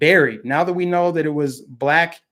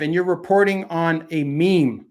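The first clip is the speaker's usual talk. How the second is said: ordinary talk, nothing marked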